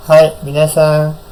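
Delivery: usual speaking voice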